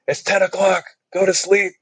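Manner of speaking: high, fussy scolding voice; mock stern neighbour. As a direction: imitate a nagging camper telling them off. Neighbour camper scolding the campers.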